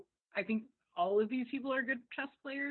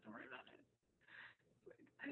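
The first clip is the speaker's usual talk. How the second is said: hushed repeat; 8 semitones lower, 27 dB quieter, much slower than usual. Retold or imitated. Repeated, drops to near whisper.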